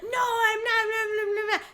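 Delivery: high-pitched mock protest; arguing back. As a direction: imitate a driver fighting back, trailing into nonsense. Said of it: "they're kind of fighting back and forth".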